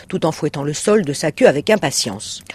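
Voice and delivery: usual speaking voice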